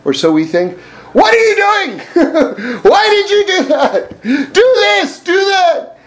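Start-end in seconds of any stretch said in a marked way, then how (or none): 1.1-6.0 high, harsh nagging voice, mock berating; mock raging voice in your head; raging inner voice. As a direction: play the inner critic yelling at you. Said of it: "if you hear raging and yelling".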